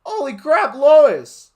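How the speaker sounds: very high, loud outburst; shocked yelp; high loud exclaim. Acted. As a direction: blurt it up top. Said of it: "Hiro said".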